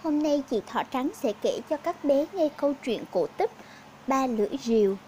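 usual speaking voice

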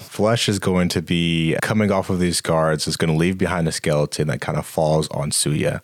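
usual speaking voice

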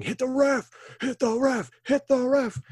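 high-pitched chanting shout; mock crowd yell. Couple of fans egging on an angry wrestler, yelling. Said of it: "start yelling".

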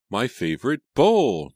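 high, loud upset cry; distraught outburst, last word stretched high and loud; screaming. A narrator's reading. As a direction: wail it out, pitch leaping up. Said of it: "Julia was screaming".

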